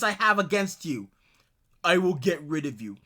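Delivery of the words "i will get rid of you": mocking his threat.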